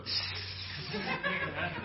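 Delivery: high airy burst of laughter; laughing at the spraying can; breathy laugh. Real, not acted. Can of pop spraying on floor.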